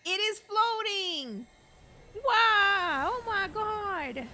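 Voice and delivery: high, loud burst of wonder; amazed. Real, not acted. Gamer reacting to the floating blade, amazed. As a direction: gasp it out in real awe. Player watching the sword float.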